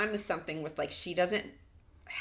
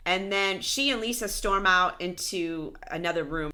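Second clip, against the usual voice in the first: ordinary delivery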